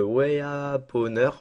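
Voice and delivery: drawn-out, slow attempt at an English accent; clumsy English accent. Real, not acted. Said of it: "avec ce magnifique accent anglais". (accent anglais maladroit, étiré)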